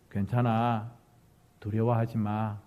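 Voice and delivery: low, gentle comforting; warm reassurance. Acted. Patting a weak friend's shoulder, warm reassurance. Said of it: "이런 따뜻함들이 필요할 것".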